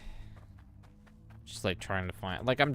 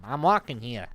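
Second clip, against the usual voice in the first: a lightly hammed-up Boston accent, louder; Boston street yell; 4 semitones higher, 6 dB louder than usual. Shown as a demonstration. Mocking coworkers from Boston, mild Boston accent yell. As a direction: throw a mild New York-Boston accent at a driver. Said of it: "It's when you yell back at 'em".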